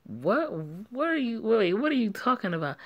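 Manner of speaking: stunned, can't believe it; shocked disbelief. Acted. Shocked by news of a baby.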